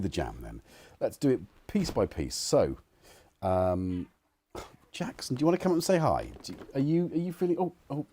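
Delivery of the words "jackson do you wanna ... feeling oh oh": high, quick coaxing voice for the dog; high pet-talk voice. Real, not acted. Streamer coaxing his dog Jackson to come up, high quick pet-talk. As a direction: coax the dog up brightly, fast.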